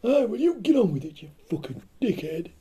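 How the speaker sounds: high shrill nag; nagging woman voice. Pretend. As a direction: snap at him in a high voice. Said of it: "she was going".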